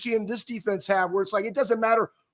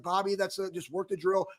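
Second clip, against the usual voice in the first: low, soft, flat-toned mock calm: "Bobby, that's a"; deadpan, quietly unbothered teammate; monotone imitation; 4 semitones lower, 9 dB quieter than usual. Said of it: "in a very, like, monotone voice".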